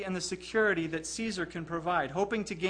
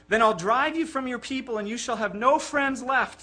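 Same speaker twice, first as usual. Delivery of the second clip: higher and louder menacing threat; threatening; 6 semitones higher, 7 dB louder than usual. A narrator's reading. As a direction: threaten him from the throne. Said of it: "threatened the emperor".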